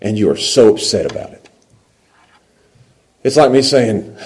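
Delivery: ordinary talk, nothing marked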